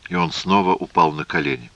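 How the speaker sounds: ordinary delivery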